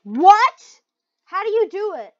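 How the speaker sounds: high, loud disbelief; exasperated amazement; baffled outburst. Real, not acted. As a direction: shout the question in bewilderment. Baffled by the game.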